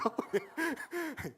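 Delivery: sudden high laugh; high burst of laughter. Real, not acted. Preacher laughs at parents changing their gaze, high laugh. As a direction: let a laugh pop out high.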